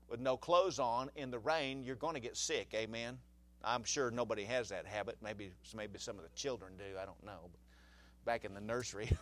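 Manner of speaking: usual speaking voice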